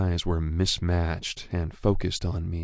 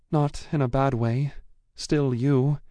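put-on higher, reassuring young woman; 7 semitones higher, 3 dB louder than usual. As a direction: gently reassure her friend. Female character reassures.

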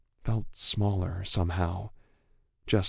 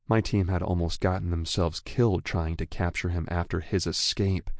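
ordinary delivery

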